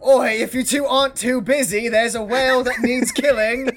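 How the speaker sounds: high-pitched shouted call to crewmates; shouting back. Acted. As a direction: holler a brusque summons, pitched well up. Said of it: "shouts back".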